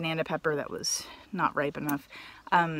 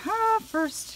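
excited squeal. Real, not acted. Thrilled at first cucumber.

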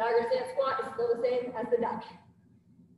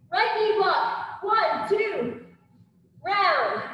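boxing coach calling combos; 6 semitones higher, 4 dB louder, much slower than usual. Acted. Instructor calling combo reps.

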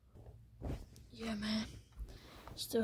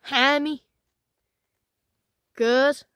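calling out; 4 semitones higher, 13 dB louder, much slower than usual. Real, not acted. Calling out to friends.

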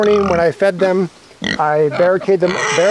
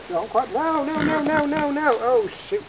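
alarmed; 9 semitones higher, 6 dB quieter than usual. Real, not acted. Pig crossing the line.

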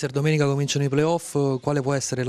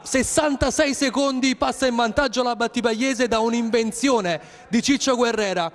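high, excited goal call; breathless italian goal commentary; 11 semitones higher than usual. Acted. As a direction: shout the goal like live radio.

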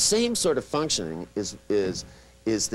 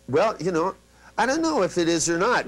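high, louder unsure reply; hesitant client; 8 semitones higher, 4 dB louder than usual. Retold or imitated. Client dodging the instruction, high and uncertain. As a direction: mimic a client dodging the task. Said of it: "the person would go".

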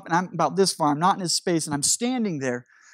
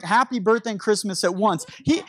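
usual speaking voice

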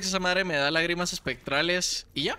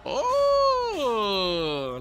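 excited; 7 semitones higher, 4 dB louder than usual. Real, not acted. Excited at good item.

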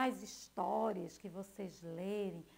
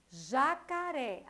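slow syllable by syllable; 3 dB louder, slower than usual. Shown as a demonstration. Teacher to young pupils, slowly sounding out Jacaré by syllables. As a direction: stretch Jacaré out slowly, ja-ca-ré, each syllable separate. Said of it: "a gente tem uma composição".

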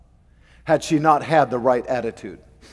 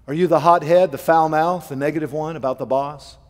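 ordinary delivery

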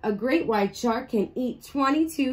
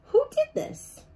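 high squeaky startled creature; tiny sea creature asking in shock; squeaky surprised voice. Acted. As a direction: squeak it out in alarm. Surprised fish voice in story.

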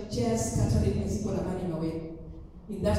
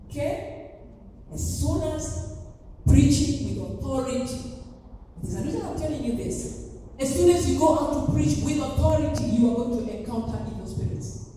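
normal talking voice

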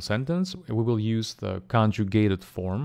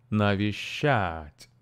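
Навещать slow, with the weight on щать; teacher's slow Навещать, stress at the end; much slower than usual. Shown as a demonstration. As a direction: draw out Навещать and land on щать. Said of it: "the stress falls on the last syllable".

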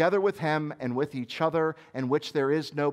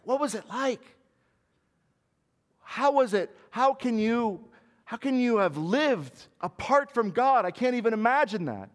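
higher, wide-eyed astonishment; amazed questioning; 9 semitones higher, 3 dB louder than usual. Pretend. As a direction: ask like someone who cannot imagine it. Unfallen beings ask, amazed.

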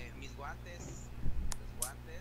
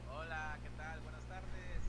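ordinary talk

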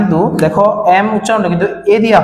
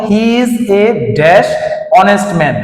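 reading the example aloud slowly; much slower than usual. Shown as a demonstration. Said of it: "যেমন দেখো".

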